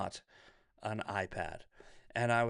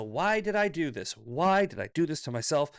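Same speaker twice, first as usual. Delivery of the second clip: louder, higher self-reproach; exasperated rant; 4 semitones higher, 6 dB louder than usual. Real, not acted. Streamer ranting about trading laptop for tablet, exasperated and loud. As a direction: berate yourself for a dumb purchase.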